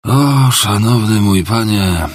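put-on a drunk clerk begging to be heard; slow pleading drunkard. Drunk clerk pleads with the stranger, slow and imploring. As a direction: drag out the address, imploring.

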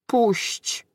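a hungover wizard pleading with an unseen force; almost pleading moan. Acted. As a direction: beg to be let go. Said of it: "wyjęczał tym razem prawie błagalnie".

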